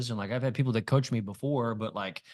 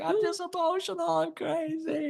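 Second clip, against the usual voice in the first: much higher, panicky inner voice; high-pitched voice of his worried side; anxious inner voice. Retold or imitated. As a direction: squeak it out like a frazzled self. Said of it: "the other part of me is like".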